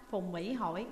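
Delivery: plain reading voice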